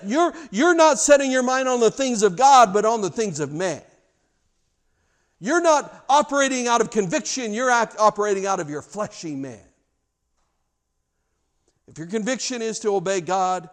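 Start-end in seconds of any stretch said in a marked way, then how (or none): 0.0-9.6 loud, high rebuke from the pulpit; full-force preaching. Acted. Preacher rebukes worldly thinking, loud and high. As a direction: thunder the rebuke at the congregation.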